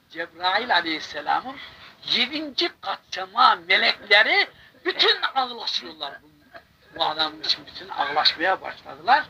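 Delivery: ordinary delivery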